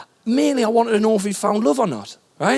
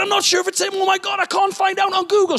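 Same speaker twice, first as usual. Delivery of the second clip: much higher, near-shouted fluster; frantic panic; 12 semitones higher than usual. Retold or imitated. Starstruck man fretting over a TV face, frantic shout. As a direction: blurt it like you're losing it. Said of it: "I went".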